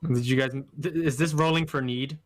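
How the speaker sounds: usual speaking voice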